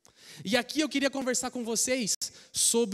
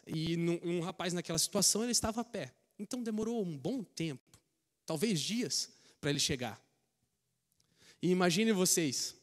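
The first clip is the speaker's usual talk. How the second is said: ordinary talk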